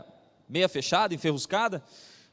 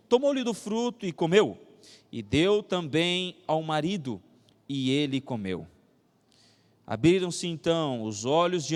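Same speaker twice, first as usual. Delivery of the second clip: scripture reading; 5 semitones lower than usual. A narrator's reading. Preacher reading Genesis to the congregation, solemn scripture reading. Reads the Bible passage aloud.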